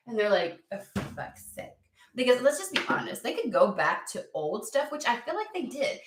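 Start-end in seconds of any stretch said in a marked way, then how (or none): 0.7-1.8 low, hushed, nearly whispered curse; exasperated mutter. Retold or imitated. Spirit fed up with the demand for joy, exasperated mutter. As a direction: curse under your breath, fed up.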